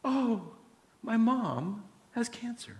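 a hushed, breathy, slow confession; waitress softly revealing her mom's illness; soft sad reply. Retold or imitated. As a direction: quietly share bad news, high and slow. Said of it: "And she went".

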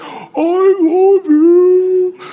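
very high, slow drawn-out dog howl of words; howling talking dog. Retold or imitated. Imitating viral talking dogs, high stretched howl. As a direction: howl it like a dog trying to talk. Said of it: "they would howl in such a way".

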